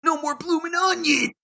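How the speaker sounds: high shouting parent; rushing dad. Retold or imitated. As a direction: hurry the family, mock panic.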